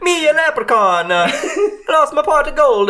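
high, loud, laughing botched Irish that slides Swedish; a failed leprechaun accent cracking into giggles; bad Swedish accent. Self-declared leprechaun mangling his accent for the podcast, high and laughing. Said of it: "You're Swedish now?".

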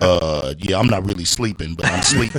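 low, sleepy sweet-talk; put-on phone voice. Man on the phone to his lady friend, putting on a sleepy low voice. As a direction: play drowsy in a deep voice for her. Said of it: "You gotta put a voice on".

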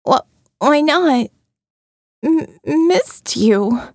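slow, halting, still half sedated; drowsy stammer. A narrator's reading. Sedated Jill struggling to ask Molly where they are, halting. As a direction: push the words out through the fog of the drugs.

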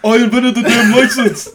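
high, loud, hammed-up senator impression; Bernie Sanders. Co-host butting into the license bit, loud mock senator voice. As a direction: shout it as a cranky comic politician. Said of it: "Fucking Bernie Sanders".

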